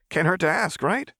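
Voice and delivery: put-on cheeky kid. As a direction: shrug it off playfully. Milo keeps voiced line.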